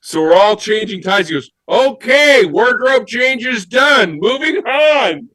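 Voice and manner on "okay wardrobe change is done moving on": high, drawn-out mock director call; triumphant imitation of a director wrapping up; director's announcement. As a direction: announce it to the whole crew. Acts out director announcing change is done.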